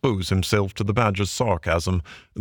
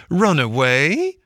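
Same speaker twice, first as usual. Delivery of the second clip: "Run away?" slow and rising, louder; scornful echo; 7 semitones higher, 4 dB louder, slower than usual. Acted. Washerwoman mocking the sergeant, "Run away?" slow and rising. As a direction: throw the words back in disbelief.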